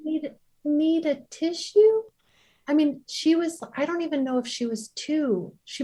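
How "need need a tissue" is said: put-on small toddler voice, much higher and slow; little child voice. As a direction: offer it like a two-year-old. Toddler offers tissue, voiced.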